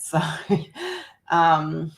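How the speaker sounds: ordinary talk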